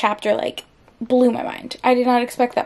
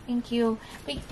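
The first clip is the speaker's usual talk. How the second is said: ordinary delivery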